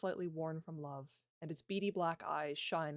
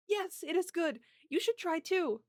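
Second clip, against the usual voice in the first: put-on excited young girl; 13 semitones higher, 7 dB louder, slower than usual. Princess voiced, delighted child.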